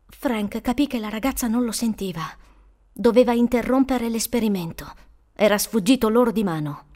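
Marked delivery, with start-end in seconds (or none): none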